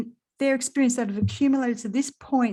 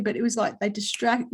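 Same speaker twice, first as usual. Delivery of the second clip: ordinary talk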